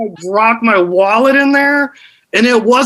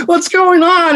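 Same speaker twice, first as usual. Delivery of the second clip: put-on girlfriend voice; 9 semitones higher than usual. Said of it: "she's just like".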